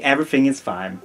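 normal talking voice

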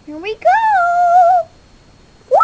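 high, loud and drawn out slowly; excited shout. Acted. Toy star hyping its own stunt, slow excited yell. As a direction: psych up the crowd before a big jump.

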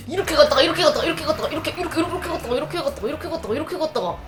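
high, breakneck run of repeated words; rapid-fire repetition. Retold or imitated. Telling how the taxi kept switching lanes, rapid-fire repetition. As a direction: rattle it off like frantic zigzagging. Acting out frantic lane weaving.

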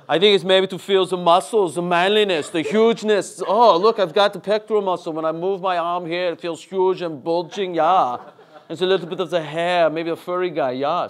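loud, hammed-up accented bodybuilder voice; puffed-up he-man showing off his bulk; muscleman. Dad parodying himself feeling his muscles, boastful muscleman voice.

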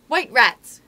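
high, loud burst; inspired cry. A narrator's reading. Nibs guessing at the story, crying out inspired. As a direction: blurt out a bright idea. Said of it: "cried Nibs, inspired".

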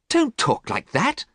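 high, slow protest; dismayed high exclamation; crying out. A narrator's reading. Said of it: "cried Basil".

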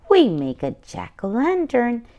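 ordinary delivery

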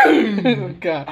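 speaking through laughter; amused laughing speech; laughing while talking. Real, not acted. Seen 0: Laughs open-mouthed at start; cake only held later, bites after 4.6s.

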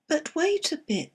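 high little oyster protest; oysters crying out. A narrator's reading. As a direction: call out, pitched high. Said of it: "the oysters cried".

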